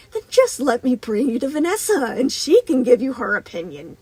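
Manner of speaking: loud, high-pitched taunt; taunting menace. A narrator's reading. Moon threatens to bring Vanessa in, loud and taunting. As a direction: push the threat out loudly, relishing it.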